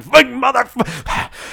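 strangled high-pitched curse, cut short; mock road-rage outburst, held back in volume; imitated yelling and cursing. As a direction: act out cursing behind the wheel, slow and high. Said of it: "I'm yelling and cursing".

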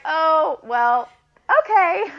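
high, loud, flustered "oh, well, okay"; taken aback. Retold or imitated. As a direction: play the stunned mom caught off guard. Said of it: "And I was like".

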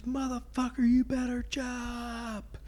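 high-pitched, slow mock sergeant threat; drill sergeant. Sergeant threatening the recruit to jump, high slow mock threat. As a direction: drag out the threat in a squeaky sergeant voice. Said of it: "He says".

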